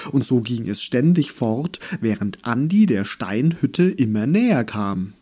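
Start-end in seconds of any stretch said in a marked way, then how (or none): none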